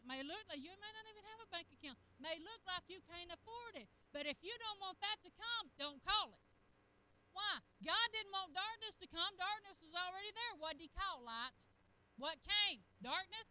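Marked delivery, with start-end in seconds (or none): none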